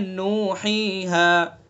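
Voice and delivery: नूहीहा with each vowel held one alif; drawn-out recitation. Shown as a demonstration. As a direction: recite नूहीहा slowly, measured. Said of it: "मिकदार एक है". (नूहीहा एक अलिफ खींचकर)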